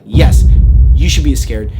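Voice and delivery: loud, firm answer; emphatic warning. Retold or imitated. Three locals answer the doubter at once, loud and stern. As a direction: hit the yes hard to alarm him.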